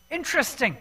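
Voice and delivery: ordinary talk, nothing marked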